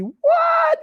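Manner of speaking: squeaky high hammed-up what; mock surprise. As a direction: fake shock for the viewers. Mock surprise at own item.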